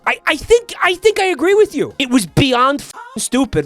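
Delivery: high indignant agreement; scathing critique. Real, not acted. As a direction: pile on, incredulous. Agreeing, outraged.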